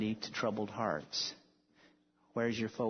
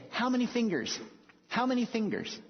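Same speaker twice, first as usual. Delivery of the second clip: high, pushy questioning voice; insistent patient; 9 semitones higher than usual. Retold or imitated. Mental patient quizzing strangers, high and insistent. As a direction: badger them with the question.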